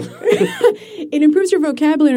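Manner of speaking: high laugh, then back to the point; real amused laugh. Real, not acted. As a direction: laugh it off and carry on. Guest laughs at host's joke.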